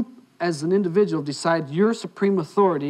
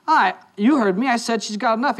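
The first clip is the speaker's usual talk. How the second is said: louder, higher, firm insistence; retold waitress digging in, pitched up; insistent; 6 semitones higher, 3 dB louder than usual. Waitress repeating her refusal to the boyfriend, insistent.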